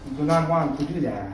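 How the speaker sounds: ordinary talk, nothing marked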